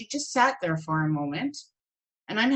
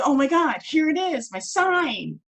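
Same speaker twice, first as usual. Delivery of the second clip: excited; 8 semitones higher, 4 dB louder than usual. Retold or imitated. Excited realization of a sign.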